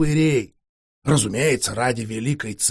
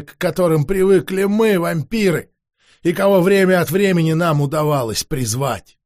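ordinary delivery

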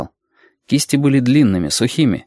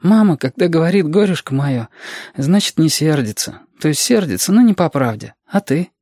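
put-on higher-pitched child figure; young Reshka, teasing; 6 semitones higher than usual. Reshka asking Alexander if he is really angry, child voice. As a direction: voice a child, lightly raised in pitch.

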